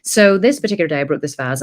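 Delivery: ordinary talk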